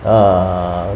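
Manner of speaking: ordinary talk, nothing marked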